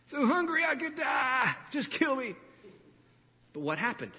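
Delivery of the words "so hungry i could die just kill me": high, whining mock complaint; hammed-up hungry moaning, pitched high; whiny complaining.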